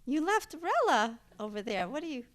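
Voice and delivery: loud, high-pitched disbelief at mom; exasperated retold outburst; retold incredulous protest. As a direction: scold your mother in shocked disbelief. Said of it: "I said".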